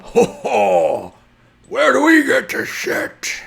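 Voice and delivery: loud, high, slow monster bellow; shouting brutish creature. Acted. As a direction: boom it out like a big rowdy brute. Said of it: "and grumbling and shouting as several, two very large creatures approach".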